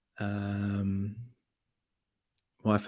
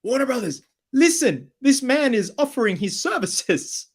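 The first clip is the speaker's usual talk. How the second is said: much higher, eager pitching plea; mock pitch to Warner; 12 semitones higher, 3 dB louder than usual. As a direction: sell it to the studio like a hyped agent. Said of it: "I'm like".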